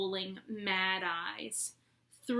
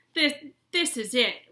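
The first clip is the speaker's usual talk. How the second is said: moaned; 5 semitones higher, 7 dB louder than usual. A narrator's reading. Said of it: "Ron moaned".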